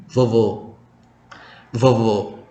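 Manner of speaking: vovo with a closed final o. Shown as a demonstration. Teacher models vovo meaning grandpa, closed o. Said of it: "Kita baca sekali kata ini".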